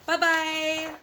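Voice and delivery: ordinary delivery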